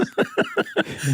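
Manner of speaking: squealing laugh; high burst of laughter. Real, not acted. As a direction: crack up at own joke. Laughs at own joke.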